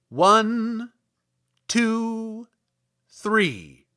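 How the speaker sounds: loud, solemn count. A narrator's reading. Old witch counts to work her magic, solemn and loud. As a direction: intone each number like a spell. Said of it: "in a solemn voice".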